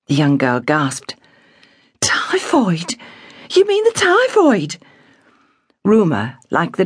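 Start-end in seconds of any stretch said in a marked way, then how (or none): 2.0-3.0 breathless whispered question; whispered gasp. A narrator's reading. Girl hearing of typhoid, whispered. 3.5-4.8 put-on much higher girl's voice, alarmed; young girl, scared and pleading; frightened girl. A narrator's reading. Girl asking her father, higher and frightened.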